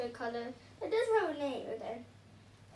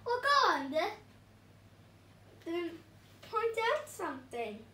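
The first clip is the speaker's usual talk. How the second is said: impatient villain; 5 semitones higher, 6 dB louder than usual. Acted.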